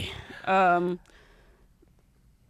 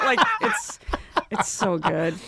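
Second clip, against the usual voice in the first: normal talking voice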